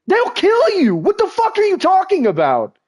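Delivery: high-pitched, incredulous outburst; exasperated shouting. Real, not acted. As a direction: shout it in heated disbelief. Heated rant at absent streamer.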